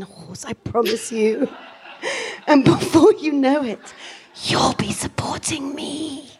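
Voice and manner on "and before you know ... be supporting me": whispered with a giggle; whispered aside. Acted. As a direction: breathe it out as a secret.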